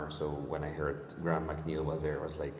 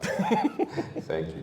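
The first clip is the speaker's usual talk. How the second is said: high laughing thanks; thanking through a laugh; 5 dB louder than usual. Real, not acted. Host laughs while thanking.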